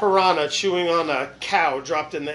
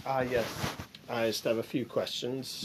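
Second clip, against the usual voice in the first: a softer, timid answer to the officer; meek detainee; 6 semitones lower, 10 dB quieter than usual. Acted. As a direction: play the nervous guy in the interview, small voice.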